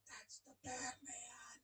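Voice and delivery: slow hushed whisper of wonder; awed whisper. Acted. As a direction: whisper like a star-struck kid. Whispered awe at boy.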